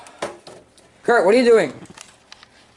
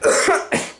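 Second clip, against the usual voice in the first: a sharp, raspy cough; real cough; 7 semitones higher than usual. Real, not acted. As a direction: cough hard, struggling for air. Choking on sprayed pop.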